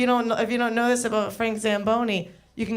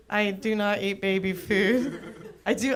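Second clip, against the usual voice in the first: usual speaking voice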